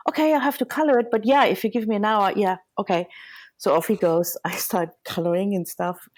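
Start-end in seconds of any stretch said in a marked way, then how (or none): 0.1-3.1 high, fast, eager yes I can; bright eager quick okay; eager reply. Retold or imitated. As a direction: rush out a keen promise. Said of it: "I'm like".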